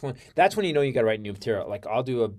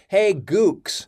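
higher, louder jab at the back of the room; imitating a hostile shout; 9 semitones higher, 10 dB louder than usual. Comic insulting workers at the back, mock loud shout. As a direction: bark it at them. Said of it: "this is what he says".

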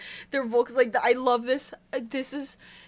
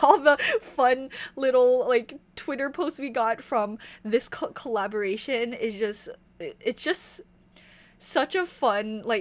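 usual speaking voice

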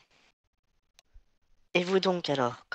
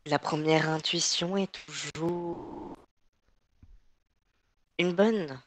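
voice changer; much slower than usual. Acted. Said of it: "ait un modificateur de voix".

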